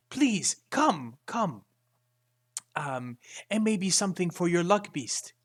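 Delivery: eager merchant. Acted. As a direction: reel the customer in with eager hospitality. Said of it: "in an accent in common, which I will not try to do the accent for".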